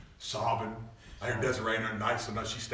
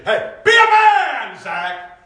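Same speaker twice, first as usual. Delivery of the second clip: much higher, louder slow shout; 18 dB louder, much slower than usual. Retold or imitated. Preacher shouts at a friend pinned to the wall. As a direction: yell it, grabbing him by the collar. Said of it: "And I say".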